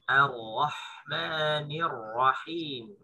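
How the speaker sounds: slow, drawn-out Ar-Rahmanir Rahim with a thin A; Quran recitation. Shown as a demonstration. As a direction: recite Ar-Rahmanir Rahim slowly, tajwid style. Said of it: "bacaannya sama dengan di basmalah".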